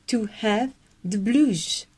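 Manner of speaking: slow British repeat; posh English accent. Shown as a demonstration. Teacher repeating for members in British accent. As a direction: say it again slowly, teeth showing, very British. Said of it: "parler british et avoir le super accent".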